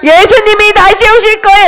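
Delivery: high, ringing proclamation; rousing street-preacher call; evangelist's cry. Acted. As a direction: announce it high to a whole crowd. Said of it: "이렇게 말했어".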